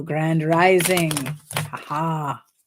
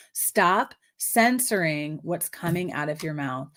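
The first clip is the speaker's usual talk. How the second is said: ordinary talk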